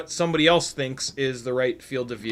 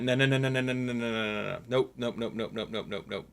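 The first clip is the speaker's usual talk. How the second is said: quick retold refusal; 2 semitones lower, 7 dB quieter, faster than usual. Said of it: "I very quickly went".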